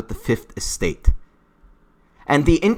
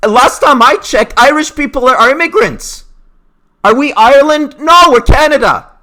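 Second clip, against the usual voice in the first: very high, loud rant; indignant shouting; 13 semitones higher, 9 dB louder than usual. Real, not acted. Vlogger arguing against Don Cherry's critics, shouting. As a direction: yell the argument at the critics. Ranting at critics' double standard.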